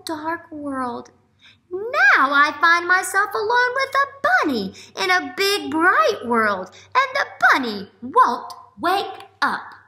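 teacher voicing the duck; little duck voice. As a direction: speak as an amazed duckling. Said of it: "said the duck".

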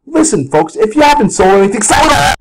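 high, urgent TV-pundit shouting; hammed-up alarmed market guru; James Cramer. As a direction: warn the viewers in a mock panic. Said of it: "This was James Cramer coming on T V".

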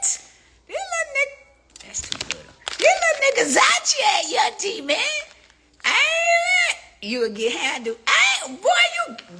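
high loud flirty squeal; excited. Real, not acted. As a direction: gush over him.

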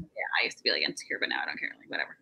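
breathy whisper, tossed off. Real, not acted. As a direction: drop to a whisper as an aside. Private aside, dropping to whisper.

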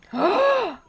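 high startled gasp; sharp gasp. Real, not acted. Viewer reacting to the show, high gasp. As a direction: gasp in real surprise.

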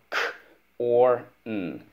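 K, or, N as separate sounds; sounding out. Shown as a demonstration. Teacher models spelling corn for pupil, sounding out. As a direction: isolate each sound: K, or, N. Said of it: "Let's go".

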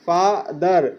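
"ফাদার" said as two beats, faa, daar; teacher splitting "ফাদার" into faa and daar; two-syllable split. Shown as a demonstration. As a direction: break "ফাদার" after the first syllable. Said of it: "এইটাকে যখন আমরা দুইবারে উচ্চারণ করছি".

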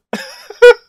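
loud, high burst of laughter; big laugh. Real, not acted. Viewer reacting to the story's twist, loud high laugh.

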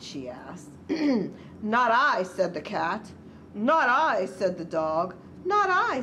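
measured picture-book narration, key words stretched; story read to children. A narrator's reading. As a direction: read a storybook aloud to little ones.

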